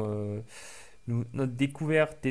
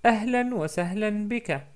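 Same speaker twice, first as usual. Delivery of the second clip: Ahlan wa sahlan bika, voiced higher as Sarah; teacher repeating Sarah's greeting, higher; higher girl's reply; 9 semitones higher, 6 dB louder than usual. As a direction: play the girl answering again, lifted. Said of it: "Donc je répète".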